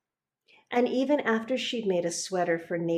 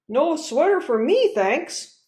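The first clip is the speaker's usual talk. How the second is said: gruff refusal; 7 semitones higher, 6 dB louder than usual. Acted. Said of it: "said Mister Crabtree".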